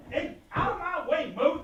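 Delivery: high-pitched shouted order; angry shout. Acted. Man fighting past the cook, angry and high. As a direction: push through shouting. Scuffle in kitchen.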